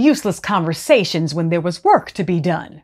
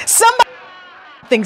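frightened plea; slower than usual. A narrator's reading. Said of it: "said another".

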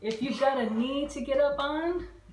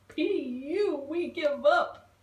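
higher, mock-disgusted surrender yell; 6 semitones higher, 3 dB louder than usual. Spiders surrendering to the stink bugs, yelled. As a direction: give up holding your nose at the smell. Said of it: "the spiders yelled".

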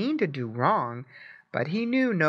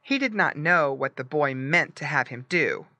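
plain reading voice

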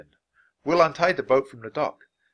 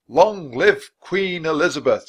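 rousing cheer; 6 semitones higher, 9 dB louder than usual. A narrator's reading. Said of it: "said Will".

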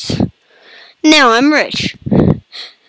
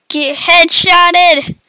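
slow taunt; 6 semitones higher, much slower than usual. Acted. Taunting after kill.

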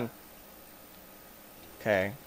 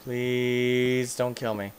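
slow plea, long stretched "Please"; beg, dragging out the first word; drawn-out pleading; much slower than usual. Real, not acted. Gamer begging the creature not to kill, drawn-out plea.

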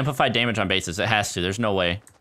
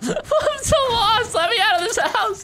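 high, drawn-out fake sobbing whine; mock wail; 3 dB louder, slower than usual. Player lost in a house, mock wailing. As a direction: wail it like a hammed-up cry.

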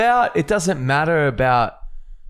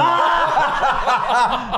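sudden high yelp with a laugh; surprised yelp; 11 semitones higher than usual. Real, not acted. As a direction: yelp as it hits. Hit by gun.

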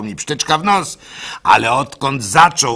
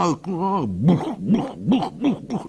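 heartbeat imitation; 5 semitones higher, slower than usual. Imitating his pounding heart.

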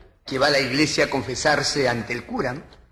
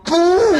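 loud high held "pum"; mock firecracker bang, drawn out; imitated explosion; 8 dB louder than usual. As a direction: boom it out like a firecracker.

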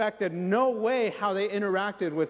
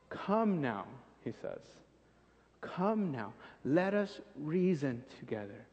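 hushed, gentle come now; soft invitation; 5 semitones lower, 7 dB quieter than usual. Acted. Preacher quietly voices God's gentle invitation. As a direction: invite them softly.

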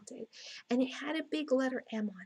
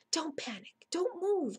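urgent warning; 7 semitones higher than usual. A narrator's reading. Jack warns Annie beside dinosaur.

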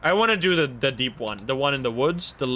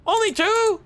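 much higher, louder, squeaked; squeaky disbelief; 4 dB louder than usual. Real, not acted. Gamer finding few ghost tracks, squeaky disbelief. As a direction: voice cracks up in disbelief. Finds only two tracks.

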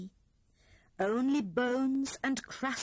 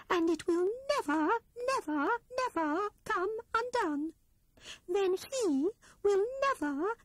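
determined Squirrel; 11 semitones higher than usual. A narrator's reading. Squirrel tells her friends how she will knot Rat's tail, proud and firm. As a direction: announce the plan with growing resolve.

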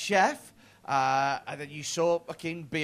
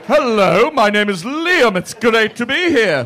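loud mock introduction without any Scouse; accent without Scouse; 8 dB louder than usual. Audience member introducing himself to the comedian, Scouse accent hidden. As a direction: imitate him hiding his Scouse accent, projected loudly. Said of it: "didn't have a Scouse accent at the beginning".

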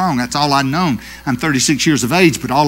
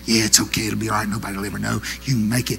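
softer, lower seductive coaxing; soothing tempter; 5 semitones lower, 8 dB quieter, faster than usual. Acted. Devil on the shoulder tempting him, soft low coaxing. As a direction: lure him back gently and softly. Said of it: "another one flew off and landed".

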